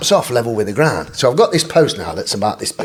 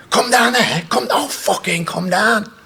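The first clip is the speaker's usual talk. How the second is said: high-pitched, drawn-out mock yell; slow, strained mock outrage; angry retort; 8 semitones higher, slower than usual. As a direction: imitate a riled-up man at a window. Imitating angry man at window.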